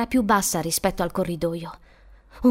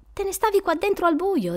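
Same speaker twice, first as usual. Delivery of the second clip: lightly voiced higher female figure; young woman's voice; 9 semitones higher than usual. Woman explaining away boy's fright, lightly voiced higher. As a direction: lift the pitch for the woman rescuer.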